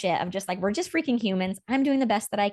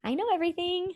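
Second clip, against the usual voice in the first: mock guru; 10 semitones higher than usual. Mocking the fake all-knowing self for Rain: high hammed-up guru. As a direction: preach it like a smug false guru, pitched high.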